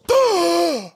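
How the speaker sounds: high, forceful mouth noise; sharp acted exclamation without words; imitated sudden noise. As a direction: burst out with a high breathy sound. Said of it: "the guy goes".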